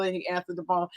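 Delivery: normal talking voice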